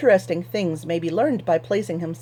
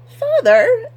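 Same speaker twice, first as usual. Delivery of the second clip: much higher, sharp daughter voice; snapping daughter; 9 dB louder than usual. Acted. Daughter snaps at her father to sell the hams, much higher. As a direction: snap at him in a high shrill daughter's voice. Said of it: "Anheldo snapped".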